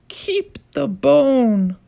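put-on lifted, childlike story voice; a high storybook voice; high character voice.

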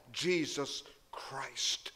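breathy whispered Christ; whispered name. Real, not acted. Preacher whispering to the congregation. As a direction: let the name fall to a whisper. Solemn close of preacher's claim.

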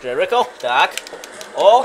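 ordinary delivery

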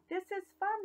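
high, bright cheer; happy animals. Acted. The animals ride around the barnyard, high happy cheer. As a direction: cheer like kids on bikes.

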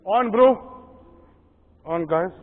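high, loud excited call; excited shout. Real, not acted. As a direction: shout out the strike. Fish just struck.

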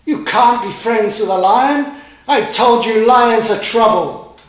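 angry daddy elephant scolding; scolding father. A narrator's reading. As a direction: scold him loud and sure.

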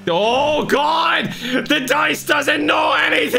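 high, loud terrified yell; panicked scream. Acted. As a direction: scream it as you fall. Screaming as taxi plunges downhill.

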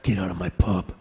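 low tough-guy voice; Wolverine. Acted. Actor retrying a soap catchphrase, low hero voice. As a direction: drop it right down, menacing and controlled. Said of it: "Now I sound like Wolverine".

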